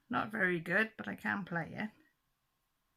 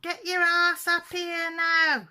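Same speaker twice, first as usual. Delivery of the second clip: higher, louder, slow bossy yell; mock shout; 9 semitones higher, 9 dB louder, slower than usual. Wife yelling at her husband to help with chores, mock shout. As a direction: bellow it like a fed-up mum calling upstairs. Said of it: "she's shouting".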